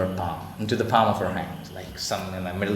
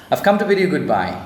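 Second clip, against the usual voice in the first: a raised, quick cry of farewell; 7 semitones higher, 7 dB louder, faster than usual. A narrator's reading. Swallow cries goodbye to the prince, raised and quick. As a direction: call out the goodbye, fast and loud. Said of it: "he cried".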